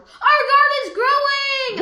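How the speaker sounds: loud, high joyful yell; child yelling happily that the garden is growing; excited yell. Acted. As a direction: shout it out with delight. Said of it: "I yelled".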